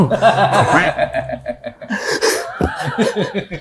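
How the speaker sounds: real long laugh; helpless laughter trailing off; tertawa. Real, not acted. Laughs at his own story. (tertawa lepas dan panjang)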